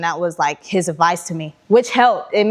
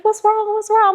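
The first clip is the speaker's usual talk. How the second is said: concerned friends; 13 semitones higher than usual. Retold or imitated. Friends in lobby asking worriedly.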